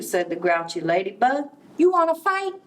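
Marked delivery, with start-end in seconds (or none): 1.8-2.5 high, pushy challenge; high-pitched cocky bug; picking a fight. A narrator's reading. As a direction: squeak out a cocky dare. Grouchy ladybug picks a fight.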